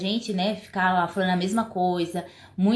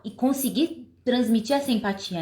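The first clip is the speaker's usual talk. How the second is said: ordinary delivery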